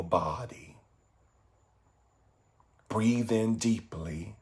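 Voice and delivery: guided meditation. Acted. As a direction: guide listeners gently inward, phrase by phrase.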